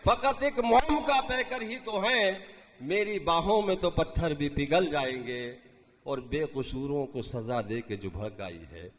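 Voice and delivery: declaimed Urdu verse; stately nazm recitation. Acted. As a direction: recite the couplet with grand feeling. Mushaira poetry recitation. (شاہانہ انداز میں نظم خوانی)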